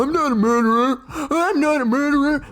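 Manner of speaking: very high, whiny mimicry of a denial; mock protest. Women answering being called a murderer, mock protest. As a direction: squeal it as a hammed-up, indignant imitation. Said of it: "then you call 'em a murderer".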